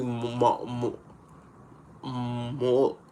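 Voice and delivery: lisping around a new tongue ring; stumbling over words with a tongue stud; tongue piercing. Real, not acted. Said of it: "I just put back my tongue ring in, so certain words are hard to say".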